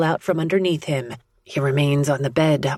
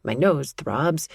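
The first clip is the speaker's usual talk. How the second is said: plain reading voice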